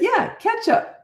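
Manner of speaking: high, emphatic "Yeah, ketchup"; insistent repeat. Retold or imitated. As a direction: spell it out for her. Insisting to cashier.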